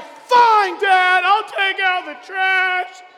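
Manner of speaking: put-on stomping kid giving in with a snarl; angry kid. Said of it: "now, you're like".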